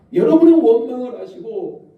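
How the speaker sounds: ordinary delivery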